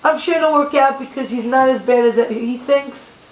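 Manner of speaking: ordinary talk, nothing marked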